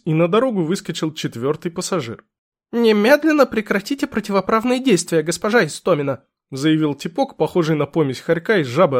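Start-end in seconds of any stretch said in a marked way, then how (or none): 2.7-6.2 higher, officious weaselly voice; weaselly lawyer. Acted. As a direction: demand it like a petty bureaucrat. Weaselly lawyer demands a stop.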